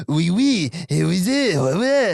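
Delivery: high, loud French chatter; excited mock Frenchmen. Imitating French carrying off rabbit.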